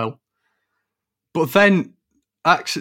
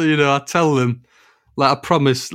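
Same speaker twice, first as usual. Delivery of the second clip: ordinary talk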